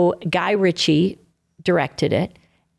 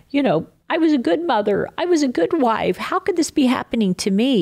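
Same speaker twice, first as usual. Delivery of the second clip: higher, quicker retold plea; wounded pleading; 6 semitones higher, faster than usual. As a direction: recount a hurt, protesting prayer to God. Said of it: "I was so wounded".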